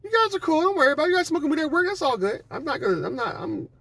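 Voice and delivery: very high sugary reassuring voice. Retold or imitated. Coworker reassures the weed smokers, very high fake-sweet voice. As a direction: fake friendliness before snitching. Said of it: "she's like".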